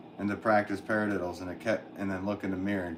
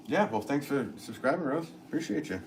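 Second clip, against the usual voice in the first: usual speaking voice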